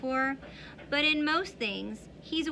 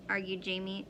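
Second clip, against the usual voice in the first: ordinary delivery, nothing marked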